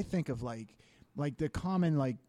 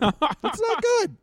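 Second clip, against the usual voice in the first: mock wail; 7 dB louder, much slower than usual. Punchline after the butt story.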